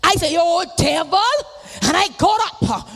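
full-force preaching. Acted. Preacher testifying to the congregation, full-force preaching. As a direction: preach it out to the saints.

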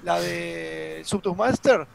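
ordinary talk, nothing marked

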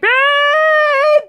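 calling husband in a panic, high and loud; panicked yell. Retold or imitated. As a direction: yell for him like it's an emergency. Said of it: "I only ever call when I'm panicking".

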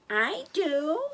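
put-on high little baby-animal voice; baby zebra voice. A narrator's reading. Baby zebra asks to be tucked in, high little voice. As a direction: pipe up as a tiny animal.

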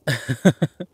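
short, loud burst of chuckling; quick amused laugh; amused chuckle. Acted. Amused by partner's unheard reply.

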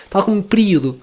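usual speaking voice

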